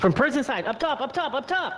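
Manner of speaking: rising, urgent repeated callout. Real, not acted. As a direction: call the enemy position fast. Urgent callout of enemy position.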